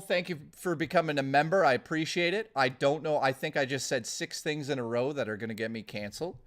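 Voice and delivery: ordinary talk, nothing marked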